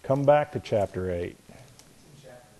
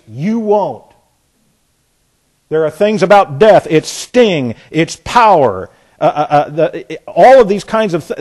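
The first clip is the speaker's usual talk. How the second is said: loud, high-pitched sermon delivery, key words punched; forceful preaching; 5 semitones higher, 9 dB louder than usual. Real, not acted. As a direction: preach it with insistence and swooping emphasis.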